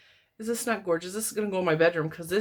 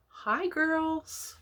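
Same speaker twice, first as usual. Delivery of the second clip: sweet high greeting; 10 semitones higher than usual. Real, not acted. Greeting the granddaughters on camera.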